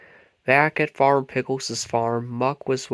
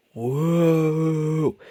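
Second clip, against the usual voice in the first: spooky noise; 4 semitones higher than usual. A narrator's reading. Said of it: "Spud made a spooky noise".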